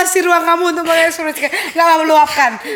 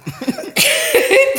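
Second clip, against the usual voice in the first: stifled giggles; 10 semitones higher than usual. Real, not acted. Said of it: "Jangan ketawa".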